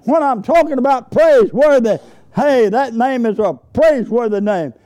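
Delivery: high, fervent shouts of praise; exultant preaching. Real, not acted. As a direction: lift up praise before the congregation. Preacher praises at full force.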